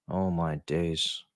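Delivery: ordinary talk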